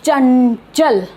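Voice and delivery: चंचल drawn out with the nasal from the throat; slow demo pronunciation. Shown as a demonstration. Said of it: "ये वाली आवाज़ हमारे गले में से निकलेगी". (चंचल को धीरे, गले से नासिक्य आवाज़ के साथ)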